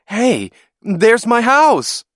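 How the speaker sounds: high, loud, drawn-out holler; excited yell. A narrator's reading. Boy spots his house from the plane, yelling excitedly. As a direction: yell the discovery over engine noise. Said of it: "I yelled".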